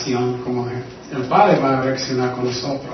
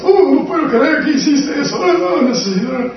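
high, far scandalized voice; mock the self-righteous gasp; judgmental Pharisee; 12 semitones higher, 4 dB louder than usual. Mocking judgmental Pharisee attitude. (fariseo escandalizado con voz aguda y exagerada)

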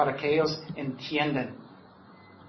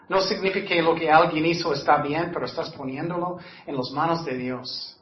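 ordinary talk

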